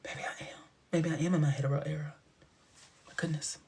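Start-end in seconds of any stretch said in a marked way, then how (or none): 0.1-0.6 very soft unvoiced murmur; whispered. Half-admitting it to herself, in a whisper. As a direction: barely breathe it.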